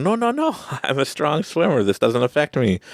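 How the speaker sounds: high-pitched, protesting self-talk; insistent denial. Retold or imitated. Swimmer to his own panic, high insistent denial.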